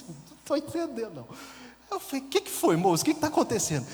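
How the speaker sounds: lost and alarmed, asking what is going on; baffled. Retold or imitated. Confuso diante do assaltante estranho. (pergunta aflita e confusa, bem aguda)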